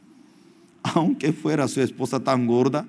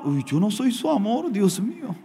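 usual speaking voice

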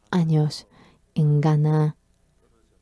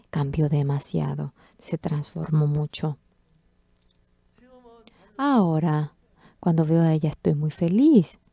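ordinary talk, nothing marked

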